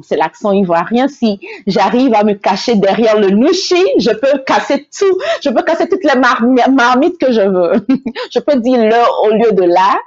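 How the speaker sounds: ordinary delivery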